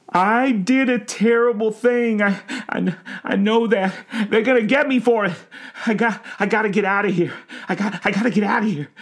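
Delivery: high, tearful, stammering panic; distraught old man frantic to escape; panicked confession. A narrator's reading. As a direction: babble it desperately, speeding up.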